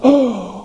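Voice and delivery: sharp gasp of sudden realization; mock gasp. Israelites realizing what they've done, with a gasp. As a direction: gasp as the guilt hits. Realizing they wiped out tribe.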